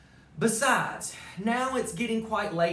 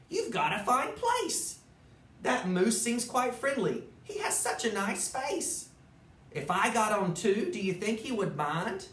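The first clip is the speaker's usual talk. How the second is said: higher, prim little spider asking politely; 5 semitones higher than usual. Acted. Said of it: "said the spider".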